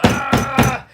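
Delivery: imitated rage yell. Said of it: "He was just mad".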